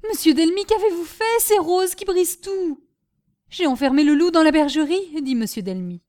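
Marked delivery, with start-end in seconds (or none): 0.1-2.8 high, loud dismay; crying out in alarm. Acted. Wife realising Rose is smashing everything, alarmed. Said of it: "s'écria madame Delmy". 3.5-5.2 raised, louder rueful exclamation; dismayed. Acted. Husband realising he locked Rose with china, dismayed. Master realises his blunder.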